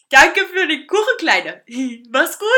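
loud, tipsy farewell with a chuckle; slurred, chuckling drunk voice; drunken slur. A narrator's reading. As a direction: call it out boozily. Drunk man says goodbye.

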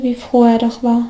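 ordinary delivery, nothing marked

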